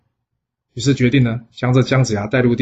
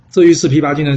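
plain reading voice

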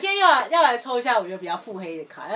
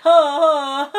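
chuckling; 8 semitones higher, 11 dB louder than usual. Real, not acted. Laughs at card.

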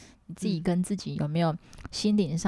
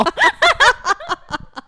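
laughing hard after the joke; squealing laugh; high laugh; 9 dB louder than usual. Real, not acted. Laughs after singing a song.

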